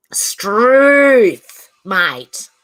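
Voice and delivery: loud, drawn-out Aussie "Struth, mate"; hammed-up Aussie. Streamer to chat, a hammed-up Aussie accent.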